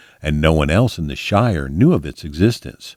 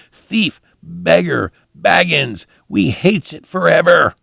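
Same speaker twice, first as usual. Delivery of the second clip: high, hissing shriek of hate; Gollum's hateful cry; 11 semitones higher than usual. A narrator's reading. Gollum screams after the fleeing hobbit, high hateful cry. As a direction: screech after the fleeing thief, full of hate and despair. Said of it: "pursued by his enemies' cries of hate and despair".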